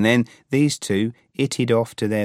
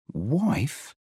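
stunned, echoing the word; gaping disbelief; 5 semitones higher, 3 dB quieter than usual. Acted. Alex hearing that Pete has a wife, stunned. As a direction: let the word fall out in disbelief. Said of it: "I, like, gaped".

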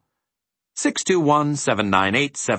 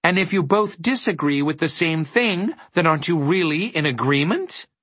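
high, pressing triumphant question; spring the trap, pitch held high; gleeful clinching argument; 9 semitones higher than usual. A narrator's reading. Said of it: "said Milo triumphantly".

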